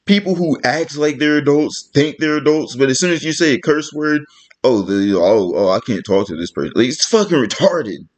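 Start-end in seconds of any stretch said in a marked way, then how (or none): 4.6-6.7 low, mock-flustered stammer; mimicked offended adult. Mimicking people upset by a curse word, low mock-offended voice. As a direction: drop the voice and imitate stuffy offence.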